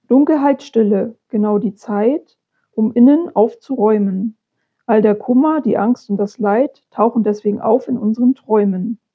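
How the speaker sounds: measured, projected poetry reading; poem recitation. Acted. Reading a poem about demons to viewers, recited. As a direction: recite your poem firmly, pausing after each line. Reads own poem aloud.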